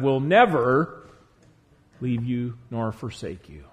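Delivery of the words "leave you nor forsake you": ordinary delivery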